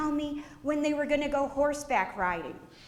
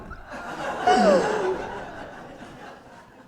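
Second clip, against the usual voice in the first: shocked gasp; 9 semitones higher, 4 dB louder than usual. Retold or imitated. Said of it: "poor Walt ... going".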